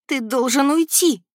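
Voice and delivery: high, loud, slow plea; near tears. A narrator's reading. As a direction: beg him, on the edge of crying. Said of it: "почти заплакала я". (почти плача, умоляюще)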